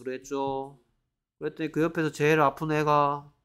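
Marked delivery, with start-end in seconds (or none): none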